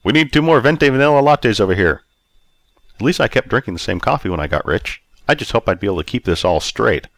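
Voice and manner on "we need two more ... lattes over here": raised, higher ordering voice; calling out. A narrator's reading. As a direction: call the order across the room. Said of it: "Rob called out".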